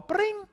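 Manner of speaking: a high, sharp vocal phone ring; imitated phone ring. Telling of the first client's call, a mock phone ring.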